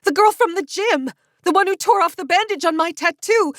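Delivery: high, loud recognition; excited shout. A narrator's reading. Frankie recognizes narrator, shouting.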